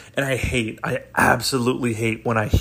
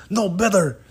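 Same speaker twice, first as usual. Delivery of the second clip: higher-pitched mock Russian command; Russian accent; 7 semitones higher than usual. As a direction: demand another try in a Russian accent. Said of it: "they were like".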